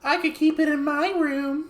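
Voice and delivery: very high mock girl voice; imitated ad girl. Co-host mimicking the girl in the phone ad, high girlish voice. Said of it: "she was kinda doing sort of like".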